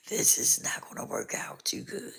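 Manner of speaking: usual speaking voice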